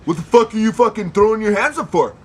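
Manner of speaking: a loud, aggressive yell at them; angry yell. Retold or imitated. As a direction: bark it like an angry stranger. Angry guys yelling at them.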